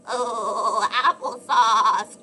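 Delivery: loud, slow pained shout; goat's cry. A narrator's reading. Goat crying out after hitting the tree. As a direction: bellow it out in pain. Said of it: "cried the goat".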